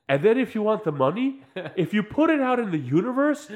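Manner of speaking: high, loud mock guru pitch; hammed-up self-help promise; mock metaphysician. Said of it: "they'll go back to the other guy".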